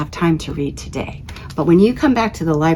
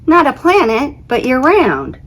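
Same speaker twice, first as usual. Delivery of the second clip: high, louder disbelieving voice; surprised planet; 8 semitones higher, 3 dB louder than usual. Acted. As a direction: protest on a friend's behalf. Planet character voiced in story.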